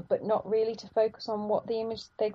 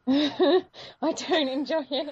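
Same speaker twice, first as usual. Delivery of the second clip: slow, higher, through laughter; laughing admission; 7 semitones higher, 4 dB louder, much slower than usual. Real, not acted. Film fan admitting dislike of bad accents, laughing. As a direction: admit it through a helpless giggle.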